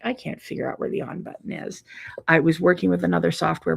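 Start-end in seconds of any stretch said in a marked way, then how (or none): 0.0-1.8 sheepish whisper. Retold or imitated. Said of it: "I'm like".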